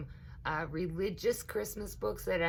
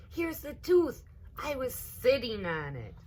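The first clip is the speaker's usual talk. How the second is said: put-on much higher, louder excited boy; little boy; 9 semitones higher, 6 dB louder than usual. A narrator's reading. Little boy announcing to his sister he found the tooth. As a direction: blurt it out like a proud little boy.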